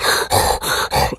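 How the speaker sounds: hollow heavy breathing, imitated. Shown as a demonstration. Showing how this guy always breathes, hollowed breath. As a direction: breathe heavily through a hollow mouth. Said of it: "it's like a fucking hollowed breath".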